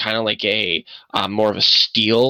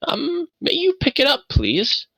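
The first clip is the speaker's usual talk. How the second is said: put-on much higher, feeble pleading old man; frail old man; 10 semitones higher than usual. Frail old man asking Buckluck to lift the box, feeble. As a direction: sound weak asking for help.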